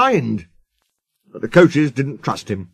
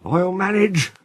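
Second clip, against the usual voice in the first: higher, breathless wheeze; puffing, effortful old engine; wheezing; 6 semitones higher than usual. A narrator's reading. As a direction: wheeze it out, short of steam. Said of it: "he wheezed".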